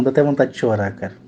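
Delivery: ordinary delivery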